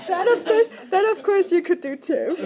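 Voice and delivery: laughing through the words; breaking into giggles mid-sentence. Real, not acted. Laughing after joke at talk.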